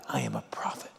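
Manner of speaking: hushed whispered claim. Retold or imitated. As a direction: breathe it almost silently. Jonah's final quiet claim.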